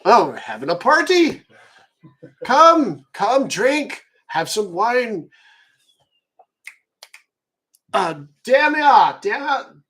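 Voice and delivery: a tipsy elder waving newcomers to the fire; jovial old host. Acted.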